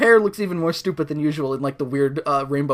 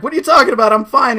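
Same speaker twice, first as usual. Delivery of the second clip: higher, louder indignant protest; indignant dancer; 7 semitones higher, 8 dB louder, faster than usual. Retold or imitated. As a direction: brush off the doctor, offended. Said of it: "Dance is just like".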